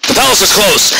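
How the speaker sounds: high, clipped guard's challenge; stern guard. Acted. Guard halting Rainbow at the palace doors, stern.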